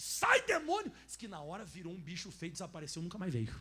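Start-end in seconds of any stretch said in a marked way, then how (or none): 0.0-0.9 high, loud command to the demon; shouted rebuke. Retold or imitated. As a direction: cast it out with a yell. (grito de expulsão, agudo e forte)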